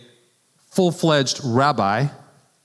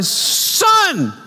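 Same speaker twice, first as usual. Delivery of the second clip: sharp high yell of the word; Mary scolding her lost son with a high shout; screaming at kid; 13 semitones higher than usual. Retold or imitated. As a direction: scold your child at the top of your voice. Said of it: "you're screaming at your kid".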